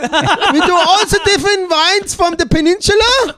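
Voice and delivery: loud, high-pitched mock German presenter, drawn-out filler; Dirk the German reviewer. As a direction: present wines as a hammed-up German critic. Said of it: "This is Dirk".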